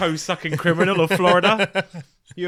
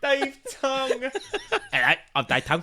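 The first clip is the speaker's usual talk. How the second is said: swollen tongue voice; 7 semitones higher than usual. Pretend. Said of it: "quite enjoying that voice".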